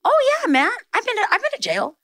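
high-pitched easygoing dad voice, tossed off; a hint of a laid-back dad, high and breezy; casual dad. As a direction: play her dad shrugging it off. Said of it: "He said".

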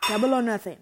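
loud, daring gamble; bold declaration. Pretend. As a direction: call it like a bet. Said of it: "I have to do it".

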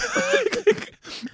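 high burst of laughter; real laugh. Real, not acted. Laughing at his own embarrassing story, high laugh. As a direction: laugh out loud at the memory.

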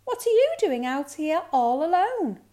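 put-on high, loud girlish question; curious girl. A narrator's reading. Girl finds cub alone, high surprised question. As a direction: ask with big swoops, high and loud.

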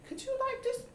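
mock plea. Wife pleads with a close stranger, high mock plea. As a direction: beg for personal space, half-laughing.

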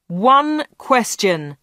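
slow, stretched mild shout. A narrator's reading. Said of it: "shouted Dave".

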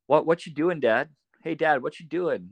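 lightly voiced young son; chatty little kid. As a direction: pester dad like a bored child. Son chattering to dad while hunting.

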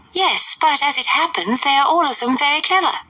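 high, fluttery mother's voice; fussy Mrs Bennet. A narrator's reading. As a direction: play the indignant silly mother.

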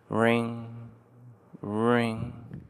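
hushed, distant "ring, ring"; soft bell imitation. A narrator's reading. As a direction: let "ring" hang like a far-off bell.

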